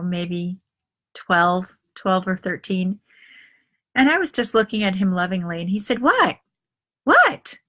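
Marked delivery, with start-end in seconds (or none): 6.0-7.6 son's voice. Retold or imitated. Son startled by mother's stare.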